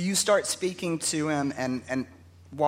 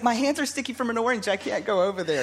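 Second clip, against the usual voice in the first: nervous PR officer; 8 semitones higher than usual. Retold or imitated. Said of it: "And he's like".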